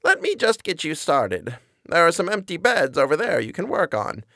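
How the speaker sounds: put-on high, sweet old lady voice; an old woman's high, quavering apology. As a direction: play a frail, kindly granny.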